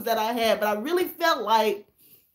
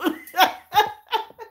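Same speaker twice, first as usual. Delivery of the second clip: a rising laugh she can't stop; giggling at the comments; real giggle; 7 semitones higher than usual. Real, not acted. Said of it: "I'm sorry".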